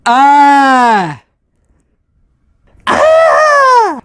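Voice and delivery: high loud scream; shrill fake scream; horror movie scream. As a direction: shriek like a horror victim. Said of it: "a horror movie scream".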